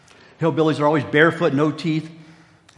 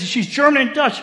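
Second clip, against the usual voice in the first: insistent wife; 10 semitones higher, 5 dB louder than usual. Retold or imitated. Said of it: "She goes".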